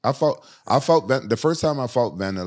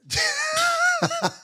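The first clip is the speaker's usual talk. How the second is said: high wheezing laugh. Real, not acted. Laughs at his own remark.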